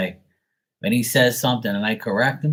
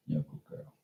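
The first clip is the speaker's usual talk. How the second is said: soft whisper to the dog; whispered praise; 15 dB quieter, faster than usual. Real, not acted. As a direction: breathe it softly to a sleepy pet.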